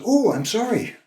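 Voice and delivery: high-pitched retold apology; startled apology. Startled apology to scolding woman.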